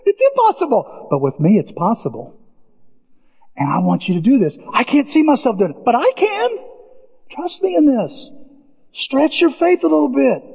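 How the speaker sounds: high, lightly voiced God; a hint of God coaxing, high; God speaking. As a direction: voice God gently urging. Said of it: "God said".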